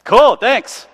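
breezy reply. Retold or imitated. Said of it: "I was like".

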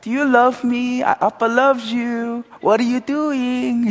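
put-on high, slow baby talk to a little son; father cooing to his baby son Josiah; cutesy talk. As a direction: coo sweetly like a proud new dad. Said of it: "he's just doing this cutesy talk".

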